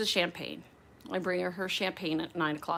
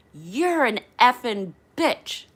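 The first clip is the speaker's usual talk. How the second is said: slow, drawn-out mock venom, loud and higher; nasty insult; 4 semitones higher, 7 dB louder, much slower than usual. Passenger insults the flight attendant to her face, nasty. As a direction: imitate an angry passenger, stretching each word. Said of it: "she does this to me".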